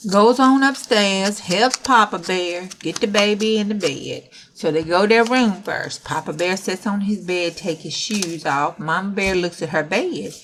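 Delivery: usual speaking voice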